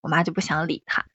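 ordinary talk

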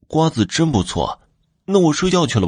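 put-on high, lightly feigned childish ease; pretending. A narrator's reading. Youngest son fooling the false grandmother, pretending. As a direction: pitch it up, playing innocent. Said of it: "便假装说道".

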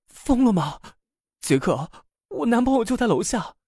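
high-pitched frantic plea; pleading, trembling. A narrator's reading. Lina warning Jack her boyfriend is downstairs, pleading. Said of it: "用几乎哀求颤抖的声音说道".

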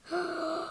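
soft breathy giant's yawn. A narrator's reading. Giant sated after breakfast, sleepy yawn. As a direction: let out a long sleepy yawn. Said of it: "Then he yawned".

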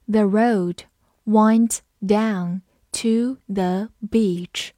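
very slow, careful read-aloud; teacher leading a slow repeat-after-me. Shown as a demonstration. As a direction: say it slowly so students can follow. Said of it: "好，跟着我慢读一遍。".

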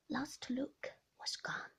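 plain reading voice

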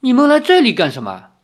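high, loud, quick question; surprised. A narrator's reading. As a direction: blurt the question out in astonishment. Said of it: "那老头惊讶地说". (惊讶地高声快问)

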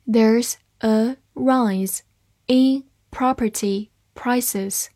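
word by word, slowly, with gaps; slow reading. Shown as a demonstration. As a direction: read slowly, each word on its own. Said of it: "慢读一遍". (慢读，一词一顿)

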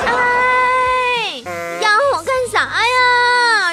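high, slow, whiny hammed-up crying call; mock wailing reply. Guo Jing answering Rong'er across the river, slow mock wail. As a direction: wail back across the river in a silly sob. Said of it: "就也回他".

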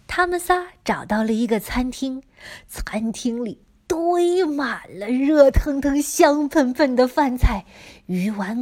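plain reading voice

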